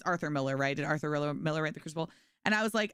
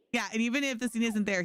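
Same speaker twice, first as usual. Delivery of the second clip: usual speaking voice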